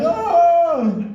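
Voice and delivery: high put-on voice oh; mock woman's oh. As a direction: imitate her startled oh. Exchanged Oh noises in cinema.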